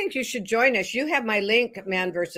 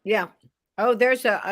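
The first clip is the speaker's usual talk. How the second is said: ordinary talk, nothing marked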